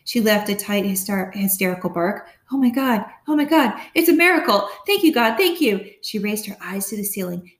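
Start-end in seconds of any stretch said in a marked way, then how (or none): 2.5-5.8 high, loud tearful thanks; rising into a joyful cry of relief; mother sobbing thanks for her lost daughter; overjoyed disbelief. A narrator's reading. Mom crying with joy.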